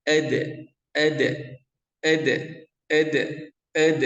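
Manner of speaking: hard pressed ed; wrong pressed ed. Shown as a demonstration. Teacher demonstrates wrong heavy qalqalah, pressed. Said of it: "Tidak boleh ed".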